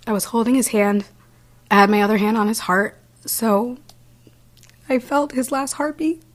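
choked up, pitch climbing with each phrase; real emotion. Real, not acted. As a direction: hold back tears as the voice rises. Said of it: "gonna get a little emotional".

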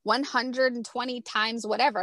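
ordinary delivery

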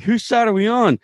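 high-pitched baffled onlookers; acted bewilderment. Soldiers watching aliens eat turkeys, acted bewilderment. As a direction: start very high, ease down while hurrying away.

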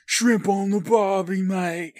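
high, put-on Aussie accent; hammed-up mock Australian; bad Australian accent. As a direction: botch an Aussie accent on purpose. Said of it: "that's not the right accent".

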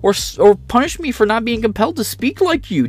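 high pleading lecture voice; Jordan Peterson impression. Streamer parodies Peterson defending free speech, high whine. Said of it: "Jordan Peterson impression".

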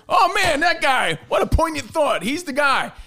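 high mocking awed fan voice; mocking impression. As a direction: gush with mock admiration. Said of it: "But when Tony Moriello says it".

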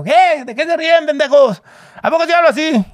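very high, loud rough champ voice; the actor copying Julio to his face; imitating Julio. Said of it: "Yo empecé a hablar igual".

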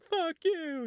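very high, drawn-out mock yell; high defiant cry. Cora refusing the screaming Abel, high-pitched defiance.

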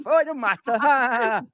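high, sneering mock dismissal and fake laugh; sarcastic mock ha ha; mocking their laughter. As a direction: parody the mates laughing it off. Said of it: "you lot might think it's a big laugh".

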